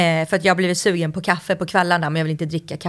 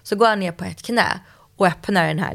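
ordinary delivery

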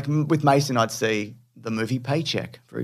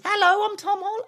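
put-on much higher little British boy voice; squeaky mock Tom Holland, far up in pitch; Tom Holland impression. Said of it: "No, it's like this".

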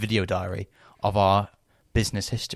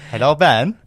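loud, higher Swedish greeting; Swedish accent; 4 semitones higher, 9 dB louder, slower than usual. Shown as a demonstration. Co-host prompts the old bit, loud Swedish accent. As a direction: call out hello in a mock Swedish voice. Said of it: "I love your Swedish one".

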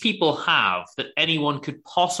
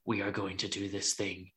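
low, soft, intense half-whisper; intense whispery imitation; 5 semitones lower, 13 dB quieter than usual. Imitating the brooding hero's low intense whisper. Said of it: "in a very intense voice, but it's slightly whispery".

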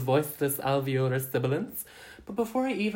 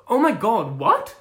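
shocked surprise; 4 semitones higher, 8 dB louder, slower than usual. Real, not acted. Flatmate reveals she is pregnant.

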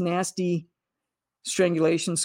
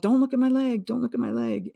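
high, urgent cry; retold yell; 5 semitones higher than usual. Injured woman yells at her sister, high and urgent. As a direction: shout it up high in alarm. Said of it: "And I yelled".

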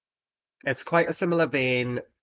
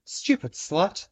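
high, slow computer-generated voice; imitated computer voice; 9 semitones higher, 3 dB louder, slower than usual. Host quoting a drag act's sound bite, imitated computer voice. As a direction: imitate a synthetic voice sound bite, pitched up and drawn out. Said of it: "like, a computer-generated voice that just says".

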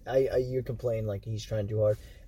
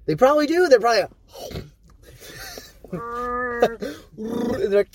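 high, loud, laughing through the words; 10 semitones higher, 5 dB louder than usual. Real, not acted. Picturing Wookiees eating Ewoks, laughing hard. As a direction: crack up while talking.

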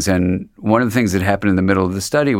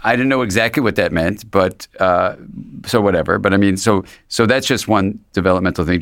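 ordinary delivery

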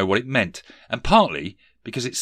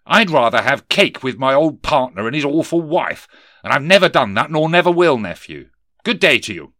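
higher, louder boss voice of a sneering Scrooge; stern miser boss; 6 semitones higher, 8 dB louder than usual. Acted. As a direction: bark it down at the clerk. Scrooge refuses nephew.

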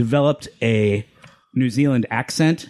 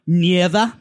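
New Zealand accent; 4 semitones higher than usual. Shown as a demonstration. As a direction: say "Never" the Kiwi way, "nɪðə". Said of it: "which I will now demonstrate for you".

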